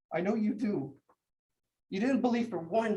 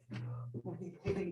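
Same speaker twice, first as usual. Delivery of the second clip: unvoiced hush to a hurt animal; whispering comfort to the dog; whispered soothing; 10 dB quieter, much slower than usual. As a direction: breathe the words gently to calm him.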